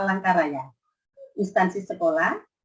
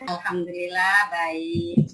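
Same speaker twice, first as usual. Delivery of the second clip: kindergarten teacher voice; 3 semitones higher, 3 dB louder than usual. Acted. Teacher addressing young pupils.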